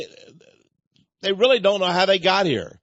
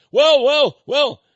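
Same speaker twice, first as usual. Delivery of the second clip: much higher and louder, imitating a stammering protest; retold sputtering; 10 semitones higher, 8 dB louder, slower than usual. Cornered man at the conference, stammering at the speaker, high and loud.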